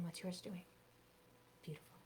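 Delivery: gently hushed classroom prompts; soft guided instruction. Shown as a demonstration. Leads a quiet scarf routine.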